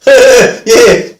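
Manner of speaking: loud, high mock yell; shouted agreement, falling at the end; exasperated offender. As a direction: imitate an emphatic, worked-up yes. Imitating offender's exasperated confirmation.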